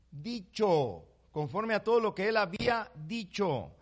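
slow, loud declaiming of the verse; solemn scripture reading. Real, not acted. Preacher reading the verse aloud to the church, solemn and slow. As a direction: proclaim the word to the church.

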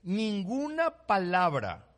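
slow, loud declaiming of the verse; solemn scripture reading. Real, not acted. Preacher reading the verse aloud to the church, solemn and slow. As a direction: proclaim the word to the church.

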